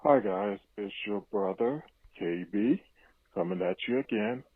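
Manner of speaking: ordinary talk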